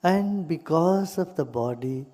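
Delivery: calm meditation guide with long pauses; guided meditation. Real, not acted. Teacher leading listeners into gratitude for body and breath, meditative. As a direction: guide them gently into stillness, unhurried.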